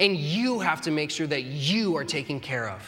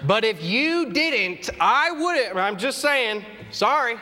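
higher, louder, sassy half-apology; snippy fake apology; 5 semitones higher, 4 dB louder, slower than usual. Husband to wife, passive-aggressive sorry, higher and louder. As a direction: take it back with a snarky edge.

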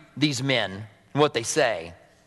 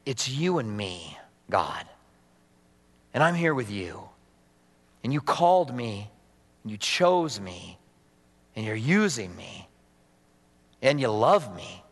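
intimate prayer; 6 semitones lower, 3 dB quieter than usual. Retold or imitated. Preacher reliving his private worship moment, hushed and tender. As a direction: speak softly to God alone. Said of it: "to say".

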